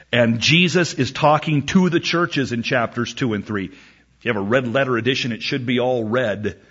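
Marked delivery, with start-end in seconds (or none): none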